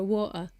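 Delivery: water with the T dropped for a catch in the throat; glottal stop. Shown as a demonstration. Explaining why people misunderstand the order, glottal-stopped water. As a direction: say wa'er, British glottal stop. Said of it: "like, a glottal stop".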